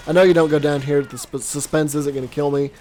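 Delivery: ordinary delivery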